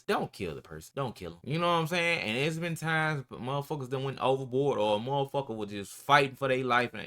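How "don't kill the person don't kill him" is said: low stern guard voice. Retold or imitated. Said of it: "Only thing they tell you is".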